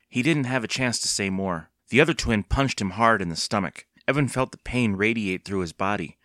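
ordinary delivery, nothing marked

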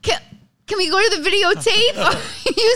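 loud, high, laughing challenge. Real, not acted. Comedian teases host about her accent, laughing. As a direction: crack up while calling out her denial.

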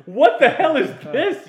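high, loud, slow outburst; appalled. Retold or imitated. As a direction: exclaim in comic horror, stretching the words. Horrified at hearing own taped accent.

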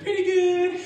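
mock wife reply. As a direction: squeal it like a flattered wife. Wife's reply voiced, laughing.

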